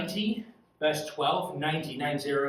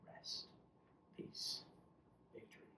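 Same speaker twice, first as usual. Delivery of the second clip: barely voiced, much softer: rest, peace, victory; hushed benediction; 24 dB quieter than usual. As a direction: let each word fall away almost silent. Closing a meditative list.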